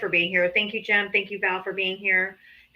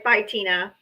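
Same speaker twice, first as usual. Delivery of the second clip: normal talking voice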